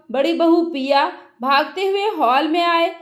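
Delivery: usual speaking voice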